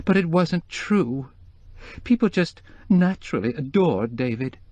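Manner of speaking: high, tearful society woman. Acted. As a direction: voice the grieving wife, high. Mrs Starkweather voice held.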